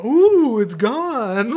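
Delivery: high playful ooh of a magic trick; hammed-up wonder for a toddler; a magician amazing a little kid, playful and high; mock magician.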